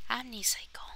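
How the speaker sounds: soft awed whisper. Real, not acted. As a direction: breathe the word in wonder. Whispered name in awe.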